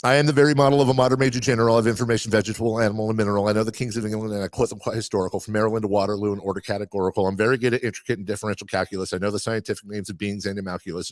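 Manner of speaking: fast patter without a single breath; all in one breath. Shown as a demonstration. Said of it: "That's one breath".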